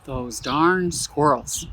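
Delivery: slow, heavy, darn stressed; grand, slow book-cover reading; slow title read. Shown as a demonstration. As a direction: savor the title word by word. Reading the title again slowly.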